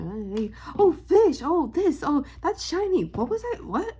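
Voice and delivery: high, scatterbrained cartoon fish; Dory. Vlogger shows how she loses her train of thought, scatterbrained. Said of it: "I'm like Dory from Nemo".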